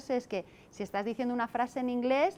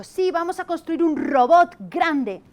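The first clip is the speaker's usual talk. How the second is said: put-on higher, louder, slow child's voice, proud; imitating a little child; 6 semitones higher, 11 dB louder, slower than usual. Another child repeats teacher's sentence, high childish voice. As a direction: play an eager small boy repeating it. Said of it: "ha venido otro y le ha dicho".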